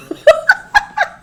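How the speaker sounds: squealing burst of laughter; high shrieking laugh. Real, not acted. Disbelief at diet news.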